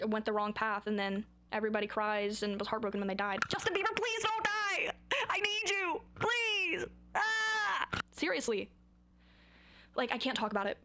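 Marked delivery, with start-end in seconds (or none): 3.5-7.9 high-pitched hammed-up sobbing wail; over-the-top tearful pleading; mock wailing plea. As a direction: beg melodramatically, high and drawn out. Mock begging the singer not to die.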